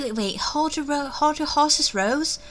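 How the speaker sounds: ordinary delivery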